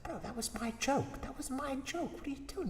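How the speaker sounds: high, soft, aggrieved; hushed protest. Retold or imitated. Peele hisses at Key in class, high and soft. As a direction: complain under your breath.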